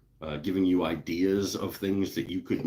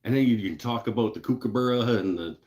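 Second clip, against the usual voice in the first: ordinary talk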